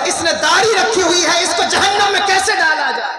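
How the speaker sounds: mercy's cry, building high and loud; calling out. Retold or imitated. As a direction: preach it as a rising proclamation. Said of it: "پکار کر کہے گی".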